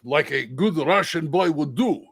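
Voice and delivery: put-on proud Russian-accented aside. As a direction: say it as a Russian dwarf. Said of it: "like a Russian".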